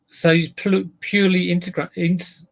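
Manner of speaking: light Welsh lilt; Welsh accent. Pretend. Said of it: "That was a Welsh accent".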